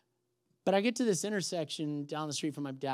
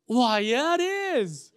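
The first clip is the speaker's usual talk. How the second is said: high, louder sing-out reply; playful sweet echo; 7 semitones higher, 6 dB louder, much slower than usual. Retold or imitated. Runner answering lady in the car, playfully sweet.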